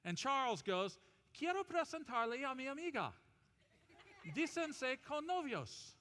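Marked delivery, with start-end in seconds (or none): 1.3-5.9 Spanish. Acted. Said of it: "God confused the languages".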